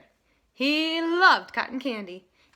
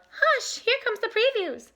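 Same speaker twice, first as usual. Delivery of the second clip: much higher voice; lovebirds; 12 semitones higher, 4 dB louder than usual. A narrator's reading. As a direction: shush him sweetly. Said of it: "The lovebirds called".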